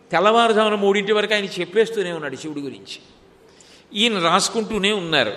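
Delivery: ordinary talk, nothing marked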